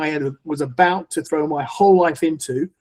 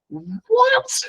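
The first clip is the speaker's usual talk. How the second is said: much higher, squeaked-out disbelieving "What?"; stunned what. Retold or imitated. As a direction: shoot way up in pure disbelief. Renunciate stranger's story stuns him.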